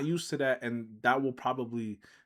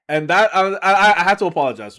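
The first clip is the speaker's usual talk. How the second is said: excited; 6 semitones higher, 13 dB louder than usual. Real, not acted. Loud while laughing.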